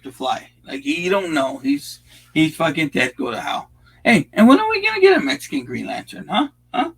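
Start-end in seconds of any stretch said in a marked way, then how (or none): 4.0-6.9 much higher, fast, excited demand. Real, not acted. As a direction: pitch way up and rush the question like a fired-up fan. Joking demand for Mexican Lantern.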